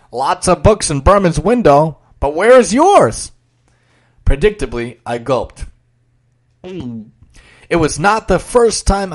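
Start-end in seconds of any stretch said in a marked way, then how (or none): 0.1-3.3 loud, pointed question; loud challenge. Acted. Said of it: "he turned to me and said".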